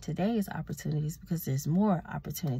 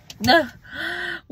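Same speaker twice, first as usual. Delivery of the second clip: loud high laugh; 10 semitones higher, 8 dB louder than usual. Real, not acted. Laughing at own question.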